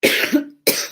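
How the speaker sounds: breathy cough as smoke catches; choking cough. Real, not acted. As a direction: hack it out, nearly voiceless. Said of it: "That went down the wrong way".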